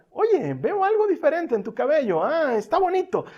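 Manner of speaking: husband complimenting wife. Acted. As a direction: play a husband pretending to notice. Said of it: "le digas a tu esposa". (marido que finge fijarse, tono agudo)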